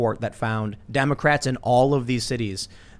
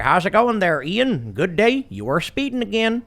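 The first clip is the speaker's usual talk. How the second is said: higher, cheery small-town officer; friendly cop; 6 semitones higher, slower than usual. Retold or imitated. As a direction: play a folksy friendly policeman. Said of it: "the cop will pull you over and be like".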